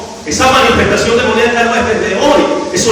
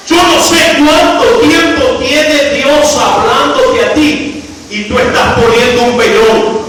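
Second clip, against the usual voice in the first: normal talking voice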